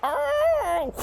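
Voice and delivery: very high surprised oh; Fred caught off guard; startled oh. Acted. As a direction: squeak it. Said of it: "as Fred's like".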